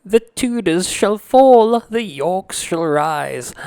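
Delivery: mock royal proclamation. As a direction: declaim it like a queen vowing vengeance at court. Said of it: "this woman goes".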